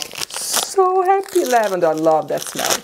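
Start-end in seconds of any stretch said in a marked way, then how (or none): 0.0-1.4 very high, slow squeal of joy; over-the-top happy. Real, not acted. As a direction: gush with joy. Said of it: "I'm over the top".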